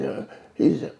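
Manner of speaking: normal talking voice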